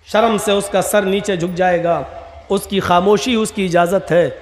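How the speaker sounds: normal talking voice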